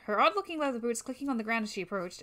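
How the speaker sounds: usual speaking voice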